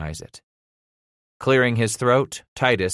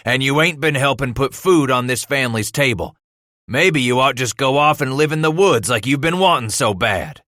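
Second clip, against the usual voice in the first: loud, raised father's scolding; angry tirade; 5 semitones higher, 5 dB louder than usual. A narrator's reading. Father telling son to leave for woods, angry. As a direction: drive home each reproach.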